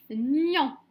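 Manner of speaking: loud, drawn-out dictated syllable; dictation voice. Shown as a demonstration. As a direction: dictate it firmly to a class, stretching the syllable. Teacher re-announcing the dictated syllable.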